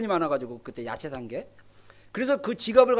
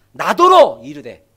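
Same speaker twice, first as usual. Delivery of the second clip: quoting scripture; 9 semitones lower, 11 dB louder, slower than usual. Acted.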